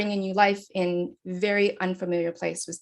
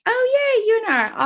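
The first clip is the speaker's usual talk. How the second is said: delighted; 6 dB louder than usual. Real, not acted. Reading chat, sees hometown university.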